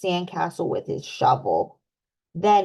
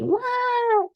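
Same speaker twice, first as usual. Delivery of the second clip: very high mock crying wah; hammed-up baby cry; toddler wail. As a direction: wail like an upset little piglet. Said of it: "he cries".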